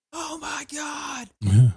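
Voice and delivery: long, very high mock cry; mock horrified wail. Bleeding man hit in the forehead, high mock wail. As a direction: imitate a man wailing in alarm, drawn out.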